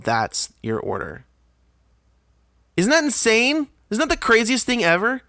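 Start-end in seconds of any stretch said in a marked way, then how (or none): 2.8-5.2 high-pitched, incredulous outburst. Real, not acted. Customer finishing the absurd ordering routine, incredulous. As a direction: exclaim in disbelief, pitch way up.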